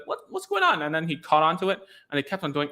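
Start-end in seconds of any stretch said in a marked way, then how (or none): 0.1-0.8 much higher, puzzled squeak; confused child. Retold or imitated. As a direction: sound baffled by the sudden fuss. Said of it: "he was like".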